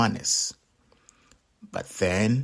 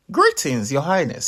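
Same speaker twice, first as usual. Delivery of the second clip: very high, chirpy and loud; high squeaky melon greeting; tiny melon voice; 5 semitones higher, 4 dB louder than usual. Acted. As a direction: chirp politely like a talking melon.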